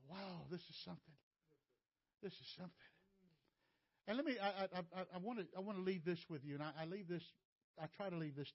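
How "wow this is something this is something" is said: very soft, lowered thinking voice; retold quiet amazement; hushed wonder. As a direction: murmur it to yourself, impressed. His awed inner thought retold.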